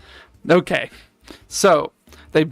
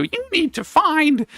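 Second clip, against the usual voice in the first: put-on old crone voice; 12 semitones higher than usual. Witch voice high.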